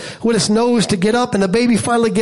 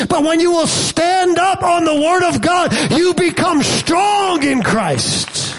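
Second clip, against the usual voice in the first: full-force preaching; 6 semitones higher than usual. Acted. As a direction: climb to the climax and shout it. Climactic preaching to a shout.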